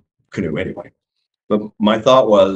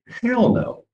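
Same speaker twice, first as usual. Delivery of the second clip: alarmed refusal; 12 semitones higher than usual. Retold or imitated. Refusing to walk into woods.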